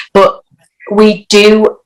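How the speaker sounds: ordinary talk, nothing marked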